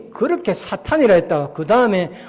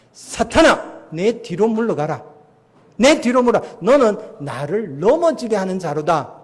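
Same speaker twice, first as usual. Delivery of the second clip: loud, high command to get behind; stern rebuke; 3 semitones higher, 4 dB louder than usual. Retold or imitated. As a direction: rebuke Satan with sudden severity. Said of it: "정색을 하셨어요".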